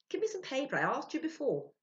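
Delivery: high, loud impatience; impatient. A narrator's reading. Ludwig to Sylvia, impatient, high and loud. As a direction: snap for the paper.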